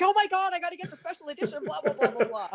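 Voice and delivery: high-pitched gushing fan imitation; mock a breakless excited babble, laughing; imitated excitement. Teasing co-host over special edition, high mock excitement. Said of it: "I was excited, and you were going like".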